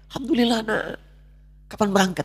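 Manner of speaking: a hint of a delighted old mother; mother's joyful voice. Mother's voice on the phone.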